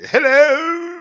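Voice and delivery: loud, high, drawn-out hello; put-on stage greeting. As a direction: belt out a silly high-pitched greeting. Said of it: "I'm gonna be like".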